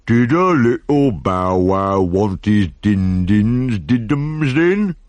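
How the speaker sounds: put-on slow, cooing baby talk to a dog; drawn-out doggy baby talk; hopeful baby talk. A narrator's reading. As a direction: coo at the pup, hinting he's hungry. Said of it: "he said hopefully".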